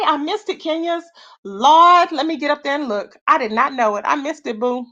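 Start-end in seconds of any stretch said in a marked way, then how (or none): none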